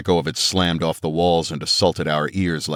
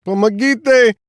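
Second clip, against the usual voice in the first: very high, loud Russian cry for help; cried out in Russian; 6 dB louder, slower than usual. A narrator's reading. Trapped man crying for help in Russian, loud. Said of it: "he said it in Russian".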